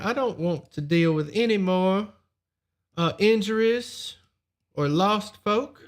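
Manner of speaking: lightly voiced smooth noble; a hint of a polite nobleman; noble's voice.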